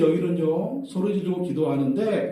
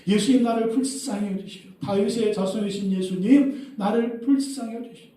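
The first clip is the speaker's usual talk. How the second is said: raised, high plea for mercy; high, insistent appeal; crying out; 7 semitones higher, 3 dB louder than usual. Shown as a demonstration. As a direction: call out to Jesus for pity. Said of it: "예수께 나와 소리 질러야 돼요".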